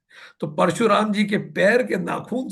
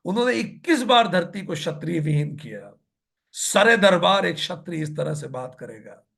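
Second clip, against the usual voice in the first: normal talking voice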